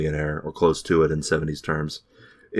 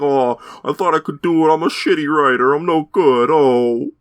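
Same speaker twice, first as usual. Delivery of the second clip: higher, whiny self-pity; pathetic whining; 8 semitones higher, 4 dB louder than usual. Retold or imitated. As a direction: whimper it like a washed-up writer. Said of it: "They make this dude so pathetic".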